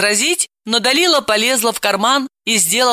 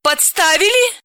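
much higher, smirking sarcasm; mock the very idea; sarcastic smirk; 12 semitones higher than usual. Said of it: "саркастичной ухмылкой спросила".

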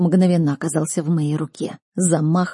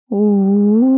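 eerie howl; 3 semitones higher, 6 dB louder than usual. A narrator's reading. Creature closing in on the group, eerie loud howl. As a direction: howl steadily like something in the dark. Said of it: "Звуки были жуткими".

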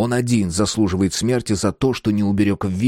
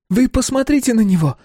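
high, loud enraged woman; furious woman; 4 dB louder than usual. Acted. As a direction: shriek it at her, enraged. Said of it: "взъярилась Камила".

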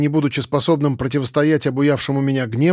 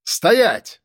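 shouted halt; 6 semitones higher than usual. Acted. Someone shouts ahead on roof.

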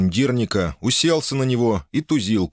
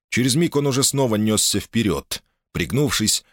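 usual speaking voice

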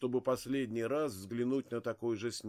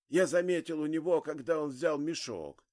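fast, rushing to finish; hurried; 4 semitones higher, 4 dB louder than usual. Acted. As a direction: race to get the words out. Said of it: "торопился договорить старик".

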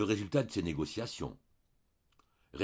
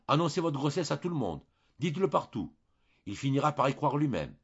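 confident, louder priestly command; firm instruction; 5 semitones higher, 4 dB louder than usual. A narrator's reading. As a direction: tell her plainly what to do.

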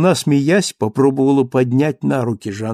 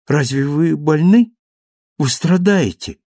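raised, worried questioning; anxious concern; 6 semitones higher, 5 dB louder than usual. Acted. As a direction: ask in mild alarm, pitch climbing. Cosette alarmed at his cold hands.

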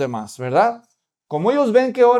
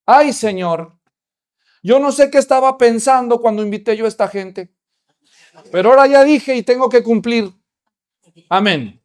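put-on child praying; 3 semitones higher, 6 dB louder than usual. Little girl prays her mother's words aloud, louder. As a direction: blurt out the prayer like a child.

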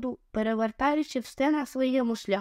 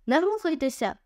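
exclaimed; 6 semitones higher than usual. A narrator's reading. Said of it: "вигукнув Рік".

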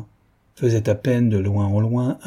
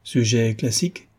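plain reading voice

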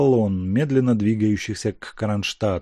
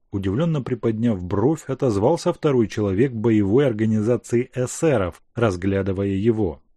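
ordinary delivery, nothing marked

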